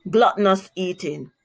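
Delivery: ordinary delivery, nothing marked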